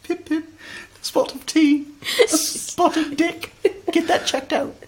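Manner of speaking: high, mannered mock-British lady; piping caricature of upper-crust British speech; the Queen. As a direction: put on a regal British accent in falsetto. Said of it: "She's like the Queen".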